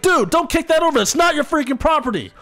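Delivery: high, indignant telling-off; mock indignant scolding. Retelling scolding the driver for kicking the can, high and indignant. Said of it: "And I was like".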